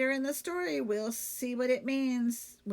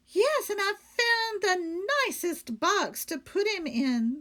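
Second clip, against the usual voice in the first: higher, louder innocent maid; Amelia Bedelia; 9 semitones higher, 5 dB louder than usual. Acted. Maid explaining the lights to her employer, higher and innocent. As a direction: explain it brightly and sure of herself.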